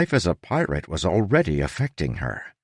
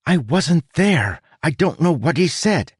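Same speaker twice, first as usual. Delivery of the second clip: put-on high-pitched young woman protesting; high, reluctant heroine; protesting girl; 11 semitones higher than usual. A narrator's reading. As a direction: object in a higher character voice. Tress protests being given a role.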